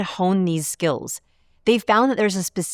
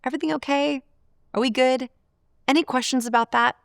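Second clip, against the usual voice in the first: higher-pitched, questioning tone; raised, friendly questions; rapport-building check-in; 7 semitones higher than usual. Acted. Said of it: "try to build rapport. Ask".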